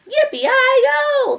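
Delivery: high, loud yippee holler; shouted cowboy whoop. A narrator's reading. Little man waving his hat, shouted. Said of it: "the little man shouted".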